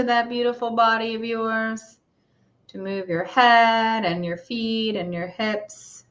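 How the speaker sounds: calm, unhurried meditation guide; guided meditation. Acted. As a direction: gently bring them back to the room.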